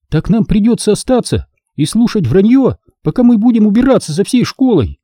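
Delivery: high-pitched indignant complaint; displeased. A narrator's reading. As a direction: protest it as an unfair chore. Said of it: "недовольно спросила Сири".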